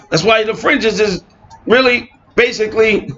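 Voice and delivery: mock them for claiming Aaron's role; incredulous mocking. Real, not acted. Preacher scoffs at listeners who keep the fringes, incredulous.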